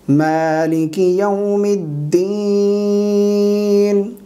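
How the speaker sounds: melodic chant with long held endings; slow, connected tajweed chanting; flowing Quran recitation. Shown as a demonstration. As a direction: recite the surah fluently, vowels sustained. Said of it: "रवानगी के साथ सूरह फातिहा पढ़ते हैं".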